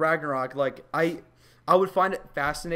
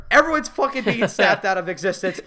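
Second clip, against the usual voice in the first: ordinary talk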